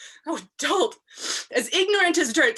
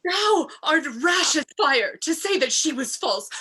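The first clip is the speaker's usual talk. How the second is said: angry; 2 semitones higher than usual. Acted. Emilia's angry outburst.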